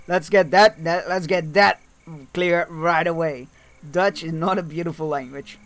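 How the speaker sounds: loud, insistent declaration; emphatic correction. Real, not acted. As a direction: set the record straight, forcefully. Firmly corrects chat about Dutch.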